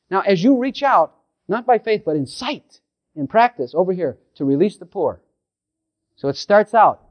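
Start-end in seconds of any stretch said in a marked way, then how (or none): none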